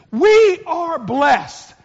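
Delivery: slow, loud, each word pressed; emphatic declaration. Real, not acted. Preacher to congregation, slow emphatic stress. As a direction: drive it home to the congregation.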